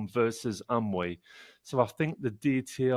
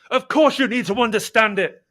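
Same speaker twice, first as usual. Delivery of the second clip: much higher and louder, shouting; outraged shout; 12 semitones higher, 11 dB louder than usual. Retold or imitated. Furious recruit yelling back at the doctor, outraged shout. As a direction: fire it back at him, yelling. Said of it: "And she went".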